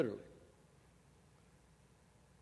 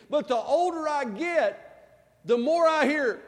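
rising preacher intensity, higher and louder; preaching; 10 semitones higher, 8 dB louder, slower than usual. Acted. Pastor preaching to the church, building in force. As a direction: build it up like a pulpit climax.

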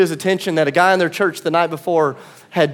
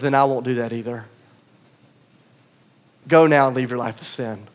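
gentle tender voice; 8 semitones lower, 4 dB quieter than usual. Acted. Jesus speaks gently to the woman.

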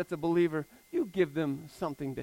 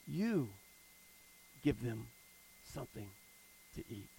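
compassionate; 8 semitones lower, 12 dB quieter than usual. Retold or imitated. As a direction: gently tell them to feed the crowd. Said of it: "he answers them with compassion".

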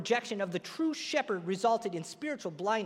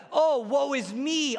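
mock whiny complaint; 5 semitones higher, 4 dB louder, slower than usual. Mocking needy people, drawn out.

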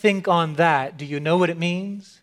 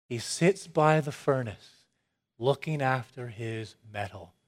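softer reflective repeat; 4 semitones lower, 8 dB quieter than usual. Shown as a demonstration. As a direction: repeat it softer and lower, musing. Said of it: "I love this".

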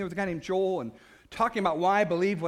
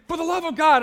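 loud, higher exclamation; shout; 9 semitones higher, 9 dB louder than usual. Retold or imitated. Scott telling him to go do it too, a loud shout. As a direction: show him how to call it out.

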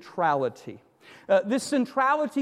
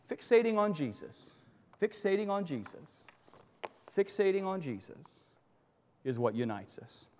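each repeat softer, fading to a hush; fading repetition; 7 dB quieter than usual. Acted. Preacher fading the phrase to the congregation, softly. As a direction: let the repeated words die away gently.

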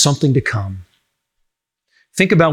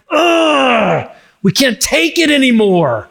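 high, strained groan of the suffering earth; 9 semitones higher, slower than usual. Retold or imitated. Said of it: "it's like".

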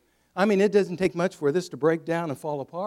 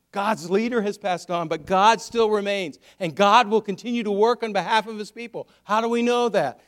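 loud, raised, emphatic sermon delivery; full-force preaching; 5 semitones higher, 6 dB louder than usual. Real, not acted. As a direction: drive the point home, building in pitch and volume.

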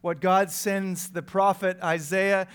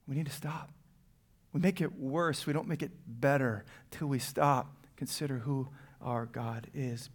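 soft and low; 4 semitones lower, 8 dB quieter than usual. Acted. Soft, low pastoral emphasis.

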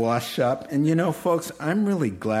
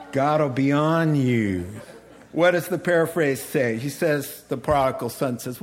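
ordinary talk

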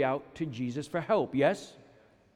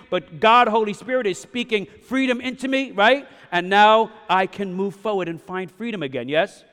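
loud, raised-pitch testimony; impassioned preaching; 3 semitones higher, 8 dB louder than usual. Real, not acted. Pastor testifying that he found freedom, impassioned preaching. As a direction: drive the message home to the congregation, loud.

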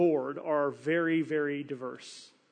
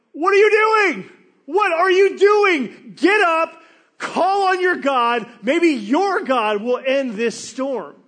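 much higher, much louder furious shout; captain yelling; 10 semitones higher, 13 dB louder, slower than usual. Retold or imitated. Ship captain finding Jonah asleep below deck, screaming at him. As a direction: scream at him to wake up and pray.

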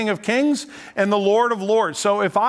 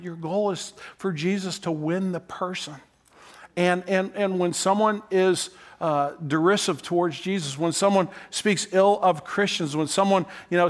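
ordinary talk

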